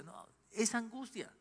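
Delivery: slow and soft, plainly admitting it; sincere correction. Shown as a demonstration. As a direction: calmly state the truth. (corrección sincera, suave y pausada)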